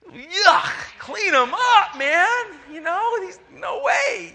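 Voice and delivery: high, loud, slow grossed-out yell; disgusted dad. Retold or imitated. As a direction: recoil and shout in disgust. Disgusted dad refuses the baby.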